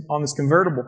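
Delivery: usual speaking voice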